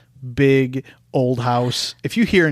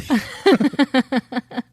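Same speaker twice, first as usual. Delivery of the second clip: high burst of laughter; 11 semitones higher than usual. Real, not acted. Big laugh.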